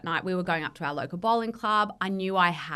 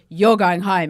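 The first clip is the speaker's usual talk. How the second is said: firm mum order; 11 dB louder, slower than usual. Retold or imitated. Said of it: "and she goes".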